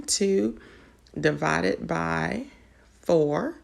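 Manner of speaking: slow, with pauses between the parts. Real, not acted. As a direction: spell out each step unhurriedly for learners to follow. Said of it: "I'm just slowing this down".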